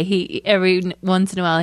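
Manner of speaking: usual speaking voice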